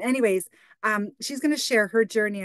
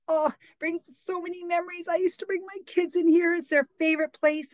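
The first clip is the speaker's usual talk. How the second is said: higher, gushing and wistful; gushing nostalgia; 9 semitones higher than usual. Retold or imitated. Telling the pizza clerk her memories, higher gushing voice. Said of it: "I said".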